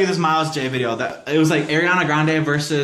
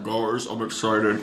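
low, slow diva impression; 5 semitones lower, 5 dB quieter, much slower than usual. Shown as a demonstration. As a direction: drag it out low and husky like a smoker. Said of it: "that's not her voice. You gotta be like".

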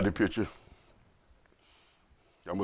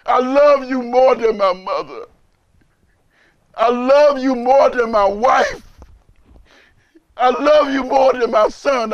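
emotional; 4 semitones higher, 6 dB louder than usual. Real, not acted. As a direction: voice breaking with emotion, louder and slow. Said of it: "Excuse me for being emotional".

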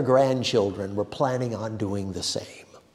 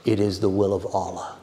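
stolid resignation; 3 semitones lower, faster than usual. A narrator's reading. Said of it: "in stolid resignation".

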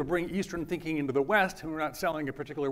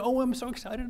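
mock excited student; 6 semitones higher than usual. Student thrilled about getting into college.

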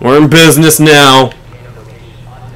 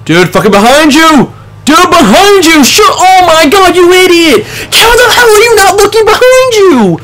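losing it at a clueless teammate; furious yelling; 11 semitones higher than usual. Real, not acted. Teammate ignores enemy behind him.

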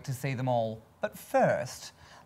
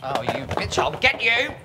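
mock outrage; 3 semitones higher, 8 dB louder than usual. Mock outrage at age insult.

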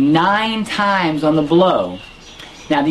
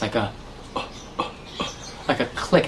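silent cough demo; 5 semitones lower, 10 dB quieter, faster than usual. Shown as a demonstration. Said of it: "create silent coughs, like this".

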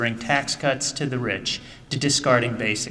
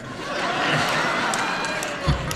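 real laugh; 3 semitones higher than usual. Real, not acted. Laughing at the contractor paying the whole cab fare.

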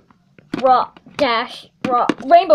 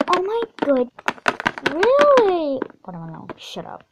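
ordinary talk, nothing marked

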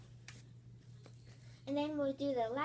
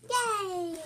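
excited cheer; 6 semitones higher, 9 dB louder than usual. Real, not acted. Celebrating a flip.

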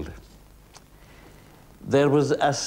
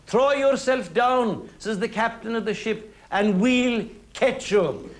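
calling up; 8 semitones higher than usual. Acted. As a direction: call it up from far below. Captain calls up from ship.

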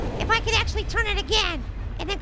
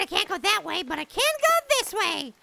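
ordinary talk, nothing marked

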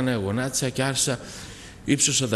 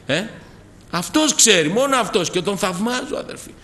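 loud, high, fervent preaching; preaching at full force; 8 semitones higher, 5 dB louder than usual. Acted. Preacher praising Jesus to the congregation, full force. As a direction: lift the whole church with it.

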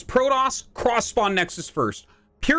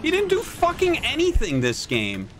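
higher-pitched angry complaint; exasperated outrage; 6 semitones higher than usual. Real, not acted. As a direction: rail at the unfair loss in a raised pitch. Outraged rant at losing.

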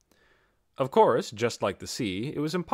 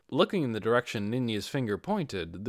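usual speaking voice

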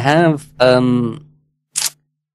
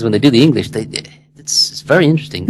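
normal talking voice

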